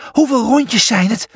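plain reading voice

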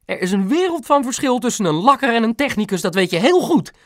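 high, fast indignant reproach; scornful correction. A narrator's reading. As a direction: lecture the husband indignantly. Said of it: "verbeterde Mam smalend". (smalend en verontwaardigd)

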